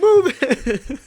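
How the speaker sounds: put-on high, loud Indian-accented singsong. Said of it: "Move It in Indian accent".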